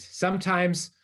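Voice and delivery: ordinary talk, nothing marked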